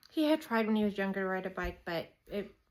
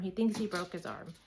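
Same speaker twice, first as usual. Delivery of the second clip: usual speaking voice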